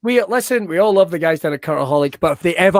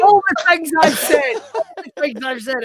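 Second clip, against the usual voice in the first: words squeezed out through laughter; high, cracking up while talking; real laughing disbelief; 8 semitones higher than usual. Real, not acted. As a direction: barely get it out for laughing. Host cracking up at the answer.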